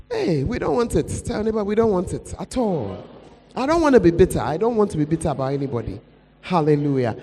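ordinary delivery

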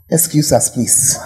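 ordinary delivery

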